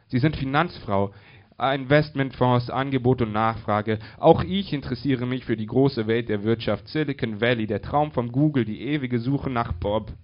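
loud, emphatic salesy oration; sweeping, punched-out speech; mock-grand declamation. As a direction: proclaim it like a grand pitch. Grand mock speech about finance.

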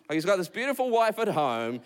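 ordinary talk, nothing marked

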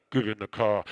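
very low, loud divine command; God. Retold or imitated. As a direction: boom it out deep as a heavenly order. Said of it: "And God goes".

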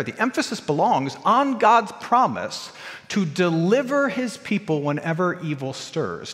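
ordinary talk

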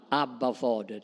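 "Abba, Fader" said softly and intimately, like calling a dad; soft, close and loving, almost to yourself; tender. Shown as a demonstration. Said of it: "Ett mera förtroligt namn för pappa".

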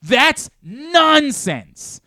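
loud, slow, high disbelief; outraged boss voice; exasperated scolding. Real, not acted. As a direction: come down hard on a dumb take. Host scolds co-host's take.

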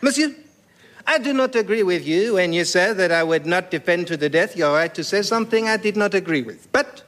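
lofty French Voltaire; French accent. Acted. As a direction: declaim grandly in hammy French. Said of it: "in outrageous French accent".

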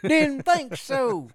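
put-on slow, high, smug old geezer; cranky old man. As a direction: drag it out like a grumpy geezer. Cranky old neighbor continues.

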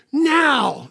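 high, loud barked "Now"; sudden yelled command. Retold or imitated. Preacher recalls commanding a young man loudly. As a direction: snap the word out like an order. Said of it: "I'd been all calm teacher".

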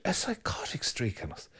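hushed whisper, as if sharing a dark secret. Acted. Priest whispering to listeners about the evil in us. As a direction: breathe it out in a whisper.

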